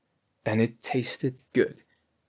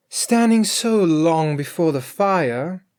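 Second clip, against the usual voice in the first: Grethel's voice; 6 semitones higher, 5 dB louder, slower than usual. A narrator's reading.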